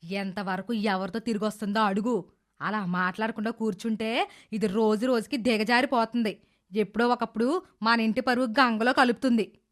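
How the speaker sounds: low, scheming mother-in-law voice. Acted. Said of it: "అప్పుడు అత్త కొడుకుతో ఇలా అంటుంది".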